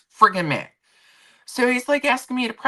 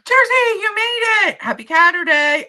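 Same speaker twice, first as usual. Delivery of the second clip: high, loud squeal of welcome; real thrilled shriek; excited greeting; 7 dB louder than usual. Real, not acted. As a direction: shout a delighted hello. Greeting a viewer who arrived.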